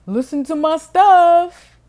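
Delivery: high, loud, dragged-out mock plea; mock begging promoter. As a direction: ham up a desperate self-promoter. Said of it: "sit up all night and say".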